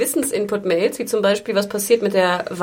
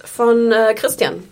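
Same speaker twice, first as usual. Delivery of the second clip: ordinary talk, nothing marked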